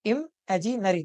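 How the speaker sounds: "इमेजिनरी" broken in three chunks; split into syllables. Shown as a demonstration. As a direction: sound out "इमेजिनरी" as im, aji, nary. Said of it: "तीन हिस्सों में टूट रहा है". ("इमेजिनरी" को तीन हिस्सों में)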